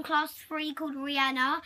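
ordinary talk, nothing marked

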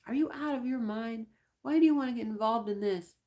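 furious. Acted. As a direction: lash out at his crewmate, furious. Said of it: "The shrimp was furious".

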